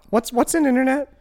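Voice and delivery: put-on high, hesitant little kid; child voice. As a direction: talk like a small kid. Plays a six-year-old kid.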